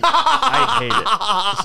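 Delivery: high, loud and slow through laughter; laughing out the complaint. Real, not acted. Repeats line, laughing second time.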